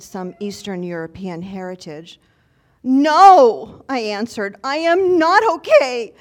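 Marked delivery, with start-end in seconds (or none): 2.8-3.8 loud, high acted protest; exasperated outburst. Exhausted pilgrim answers a stranger asking after her, acted loud outburst. 4.6-6.1 loud, high acted protest; exasperated outburst. Exhausted pilgrim answers a stranger asking after her, acted loud outburst.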